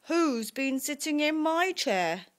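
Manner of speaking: story-time outraged father bear; Daddy Bear. A narrator's reading. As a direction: demand it crossly, climbing in pitch. Daddy Bear finds chair used.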